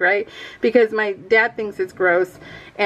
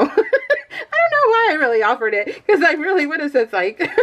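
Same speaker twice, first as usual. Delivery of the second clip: giggling through the words, voice pitched way up; laughing; 6 semitones higher than usual. Real, not acted. Mother giggling to viewers about offering beer. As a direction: laugh it out, high and breaking. Laughing at her own joke.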